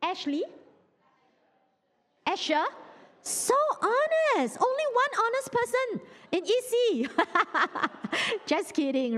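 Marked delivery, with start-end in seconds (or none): 3.2-7.1 teasing mock amazement. Real, not acted. Teasing the congregation's one honest answerer. 7.1-8.4 high, short burst of laughter; teasing laugh. Real, not acted. Laughs after teasing the congregation.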